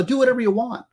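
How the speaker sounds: high, careless parent voice; mock permissive parent. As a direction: toss it off like a parent who gives up.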